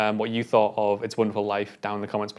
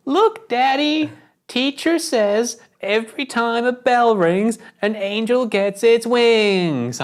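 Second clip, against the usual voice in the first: high, slow, bad little-girl American accent, much higher; deliberately bad accent; 12 semitones higher, 4 dB louder, much slower than usual. Mocking the girl's last line in a bad American accent. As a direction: ham up a terrible American child accent, slowly. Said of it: "you purposely doing a shit accent".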